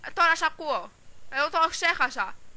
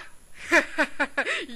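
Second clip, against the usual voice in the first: short laugh in a quarrel; mocking laugh; 3 semitones higher than usual. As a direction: laugh off the threat.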